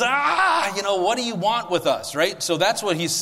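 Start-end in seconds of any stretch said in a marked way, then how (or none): 0.0-2.2 high scream breaking into a raised, rattled question; hammed-up demon freak-out. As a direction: act a demon panicking at who it sees. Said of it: "he's freaking out".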